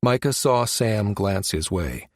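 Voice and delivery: ordinary delivery, nothing marked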